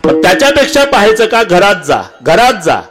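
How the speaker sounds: high-pitched, insistent kirtan oratory; impassioned preaching. Real, not acted. Preacher showing the congregation a father weeping alone, impassioned. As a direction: build the picture in mounting, pleading phrases.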